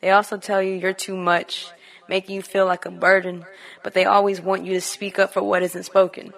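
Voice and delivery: measured, deliberate poetry recital; spoken word poem. Acted. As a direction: recite it line by line, weighing each phrase.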